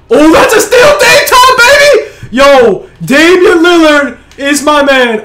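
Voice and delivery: high, loud screams with a laugh; screaming. Real, not acted. Gamer celebrating a steal, screaming high with laughter. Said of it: "I'm sorry if I'm screaming".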